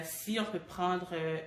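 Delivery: normal talking voice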